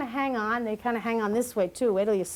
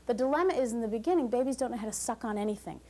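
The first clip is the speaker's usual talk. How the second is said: normal talking voice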